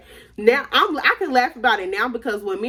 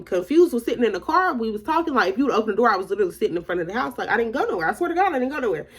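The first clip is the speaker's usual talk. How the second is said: usual speaking voice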